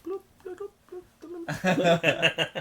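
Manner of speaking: high-pitched little bloop noises; imitated distant bloops. Bandmate mimics sound heard in the distance, playful bloops. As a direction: mimic bubbly bloops from far off. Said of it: "you hear off in the distance".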